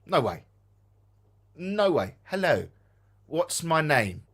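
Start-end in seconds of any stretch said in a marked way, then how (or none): none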